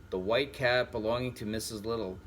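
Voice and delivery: ordinary delivery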